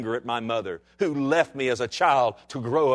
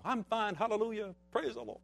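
mock cheerful churchgoer; 10 semitones higher, 7 dB quieter than usual. Churchgoer hiding pain behind mask.

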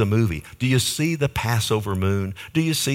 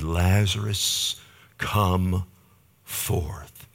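solemn command; 3 semitones lower, much slower than usual. Retold or imitated. Jesus calling Lazarus from the grave, slow solemn command. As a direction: call the dead out, word by word. Voices Jesus raising the dead.

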